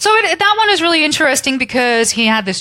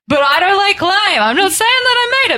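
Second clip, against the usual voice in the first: mock coy denial; 6 semitones higher than usual. Mock voicing Gray's false modesty.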